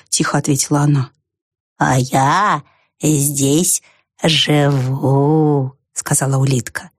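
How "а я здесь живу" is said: ponderous, drawn-out snail voice; unhurried, pondering creature; slow snail. A narrator's reading. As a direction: draw out each word lazily. Same slow snail character.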